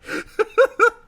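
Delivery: high squealing laugh breaking out; can't hold the laugh in; burst of laughter. Real, not acted. Laughing at the fanfic.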